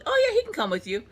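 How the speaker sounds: parodied friendly nurse; mock sweet nurse. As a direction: chirp it like a cheery nurse. Voicing nurse's reply.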